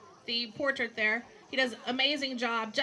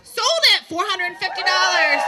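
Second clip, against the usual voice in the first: loud, high closing call; shouting; 5 semitones higher, 12 dB louder than usual. Real, not acted. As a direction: slam it home, sold. Auctioneer closes the sale.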